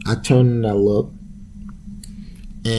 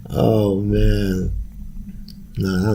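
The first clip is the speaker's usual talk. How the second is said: ordinary talk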